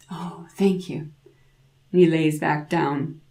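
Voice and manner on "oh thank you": breathless whispered thank you. A narrator's reading. Grandmother whispering thanks to the drowsy devil. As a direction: hush it so he sleeps.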